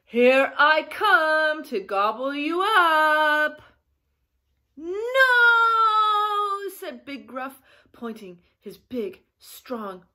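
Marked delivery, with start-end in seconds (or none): 0.0-3.6 loud, slow bellow, swelling at the end; bellowing troll. A narrator's reading. Troll jumps up at big goat, bellowing. 4.8-6.7 huge, high, drawn-out shout; defiant bellow. Acted. Big goat stands up to troll, bellowing.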